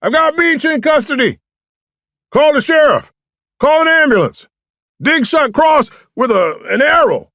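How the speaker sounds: very high, loud long-distance shout; yelling back. A narrator's reading. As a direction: holler a report across the woods. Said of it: "French yelled back".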